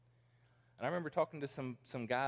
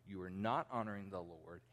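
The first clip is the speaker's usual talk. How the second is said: ordinary delivery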